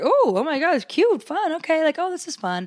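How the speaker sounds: high, delighted gasps at the mirror; excited. Retold or imitated. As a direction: gush over a cute dress. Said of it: "I was like".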